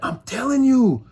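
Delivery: high, loud, slow insistence; insistent. Retold or imitated. Onlooker insisting to a doubting friend, loud and high. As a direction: swear it's true.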